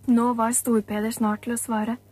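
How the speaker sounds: usual speaking voice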